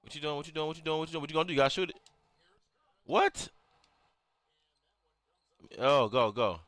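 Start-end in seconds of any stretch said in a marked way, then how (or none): 3.0-3.5 high, rising incredulous yelp; shocked disbelief. Real, not acted. Player stunned by a missed play, shocked disbelief. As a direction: question it sharply, pitch jumping up.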